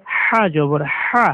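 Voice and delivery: slow হা from deep in the throat; a slow, throaty হা. Shown as a demonstration. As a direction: breathe the হা from the throat. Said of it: "গলায় শস্য শব্দ হবে".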